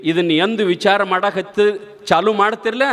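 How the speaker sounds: ordinary talk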